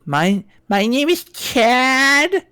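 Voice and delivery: slow, high, put-on hunk voice. Showing off on co-host's request, hunk voice. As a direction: stretch it out as a hammy hunk. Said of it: "do your hunk voice".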